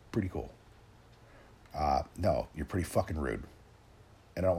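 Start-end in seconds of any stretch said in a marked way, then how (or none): 0.1-0.5 a dry, quieter echo of her words; sarcastic repeat of the reply; sarcastic deadpan echo. Retold or imitated. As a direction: repeat it back deadpan and unimpressed.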